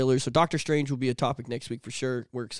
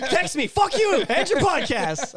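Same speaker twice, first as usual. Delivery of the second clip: squeaky prank voice; 13 semitones higher, 3 dB louder than usual. Pretend. Mock prank-caller rant.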